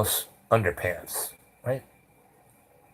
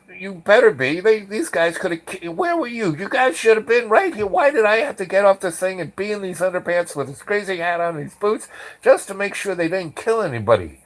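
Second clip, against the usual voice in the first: high-pitched, exasperated rant; reenacting an outraged telling-off; indignant scolding; 10 semitones higher than usual. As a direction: let the complaint pour out, voice raised high. Teller angrily scolds apologising officers.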